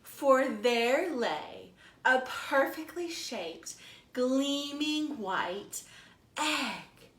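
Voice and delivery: slow, lively storytime narration; animated picture-book storytelling; reading to children. A narrator's reading. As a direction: read it like a librarian to little kids. Librarian reads picture book aloud.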